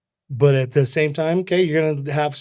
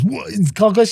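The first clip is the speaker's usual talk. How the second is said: mock disbelief; 8 semitones higher than usual. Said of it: "He goes".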